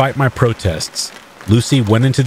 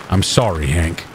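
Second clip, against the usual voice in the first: sad; 3 semitones lower than usual. Acted. Chief breaks bad news.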